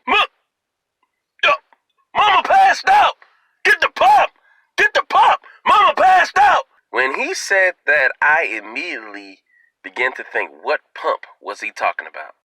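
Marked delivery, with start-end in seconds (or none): 0.1-6.6 high panicked yelling; panicked shout. Retold or imitated. Uncle yelling to the church that mama passed out, panicked high shouting. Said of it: "He stood up and said this".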